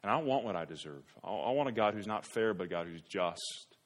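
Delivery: ordinary talk, nothing marked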